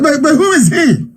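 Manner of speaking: lightly mocking a British official; mock puzzled official. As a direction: ask like you have never heard the name. Retold British official asking.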